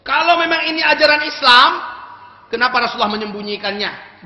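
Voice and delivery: loud, high indignant challenge; challenging shout. Retold or imitated. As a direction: call him out, loud and pointed. Angry challenge to friend. (menantang dengan suara keras)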